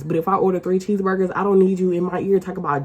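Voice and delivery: ordinary talk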